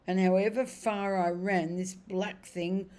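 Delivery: slow, heavy horse voice gaining force; a plodding old horse dredging up memories; remembering cab horse. Acted.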